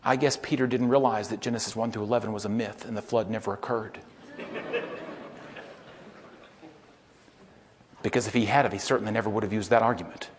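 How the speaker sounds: dry sarcasm. Acted. Sarcastic aside, dropped voice.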